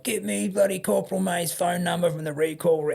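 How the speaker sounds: high, strained mock yell without volume; retold fuming captain; mock shout. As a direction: imitate an angry order to the clerk. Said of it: "he shouts to Julio the clerk".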